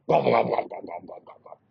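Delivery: gobble like a monster turkey; the fire eater's turkey noise; scary turkey gobble. Shown as a demonstration. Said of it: "making that noise".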